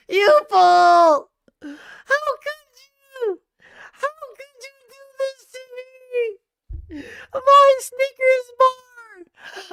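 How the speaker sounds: very high, hammed-up rage sobbing; crying with rage. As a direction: wail and scream in mock despair. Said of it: "That's how I felt when I lost".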